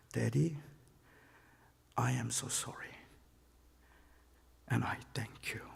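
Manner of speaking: soft, tearful whispered apology. Retold or imitated. Son in the car thanking his father, tearful whisper. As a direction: whisper it through tears.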